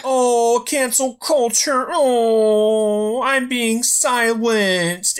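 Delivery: high, slow whiny mock of a complaining comic; mocking Bill Burr. Mocking comedian who whines about being silenced. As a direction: drawl it out as a self-pitying celebrity. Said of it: "That's a really good Bill Burr impression".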